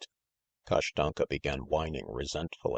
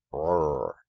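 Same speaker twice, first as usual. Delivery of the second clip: louder voiced imitation of a growl; a lightly acted doggy growl; dog's growl; 5 dB louder than usual. A narrator's reading. Kashtanka answers the talkative gander, lightly growling.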